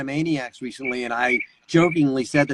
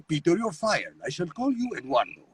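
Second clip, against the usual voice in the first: put-on softer accented character voice; Mister Weed; 6 dB quieter than usual. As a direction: fire him in a soft, accented boss's voice. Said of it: "I was Mister Weed on Family Guy".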